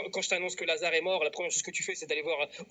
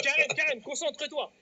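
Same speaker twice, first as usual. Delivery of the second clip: high, raised-voice scolding; exasperated call to order; 9 semitones higher, 4 dB louder than usual. Retold or imitated. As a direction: snap a confused listener back to attention. Rappelle à l'ordre qui ne comprend rien. (rappel à l'ordre exaspéré, aigu et fort)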